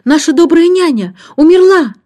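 put-on high, loud girlish wail of dismay; horrified cry. A narrator's reading. Антея learning the old nanny has died, horrified cry. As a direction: cry out the terrible news. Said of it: "закричала Антея".

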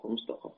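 a quick, quiet فمستقر with an a after the ق; fatha on the qaf. Shown as a demonstration. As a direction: drop the voice on فمستقر, qaf with an open a. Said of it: "بفتح القاف".

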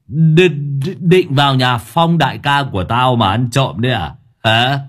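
put-on loud drunken scolding at the gate; drunken cursing. Drunk old man yelling at ghosts by the gate, angrily. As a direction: curse them out like a tipsy bully. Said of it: "đi ra cổng mà chửi".

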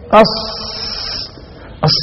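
"أص" with a long sustained hiss; sad articulation demo. Shown as a demonstration. Teacher telling students to feel it, long hissed "أص". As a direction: let the s of "أص" hiss on. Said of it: "حس كده".

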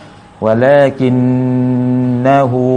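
วะลากิน นาฮู with a long hum through the nose on the doubled n; nasal ghunnah. Shown as a demonstration. Teacher shows learners the ghunnah, long nasal n. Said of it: "กุนนะที่จมูกนะครับ".

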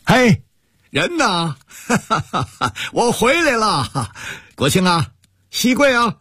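high cheerful call with a laugh, slow on 我回来了. Acted. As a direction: call across the courtyard, arriving home. Arriving home, calling out cheerfully.

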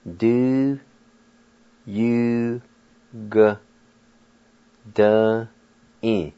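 "Do, you, g. Da-in." broken into separate syllables with pauses; Cherokee word spoken syllable by syllable. Shown as a demonstration. Teacher demonstrating the Cherokee word for true to learners, syllable by syllable. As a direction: sound out "Do, you, g" one syllable at a time, then "Da-in". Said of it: "You just pronounce the G sound like this".

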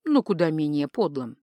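ordinary delivery, nothing marked

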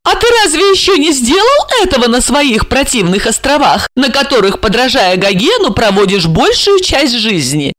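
лёгкий каприз, выше, с надутыми губами; надуть губки; капризно. Acted. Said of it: "капризно надувая губы". (Ольга капризно упрекает Жарона)

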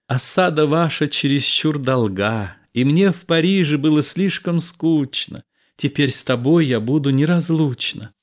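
lively Roxane. A narrator's reading. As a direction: play a carefree, devoted lady. Reader plays Roxane in higher voice.

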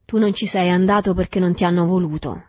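ordinary delivery

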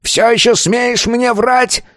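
high-pitched, raging elder; furious growling. A narrator's reading. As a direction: rage at the pupil on a strained high pitch. Elder keeps raging at pupil. (гневный рык на высоких нотах)

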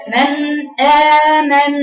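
"من آمن" loud and high with a tight hamza; forceful model recitation of "من آمن"; clear hamza. Shown as a demonstration. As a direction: narrow and strike the "آ" of "آمن". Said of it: "تضيقي المخرج عليه وتوضحيه".

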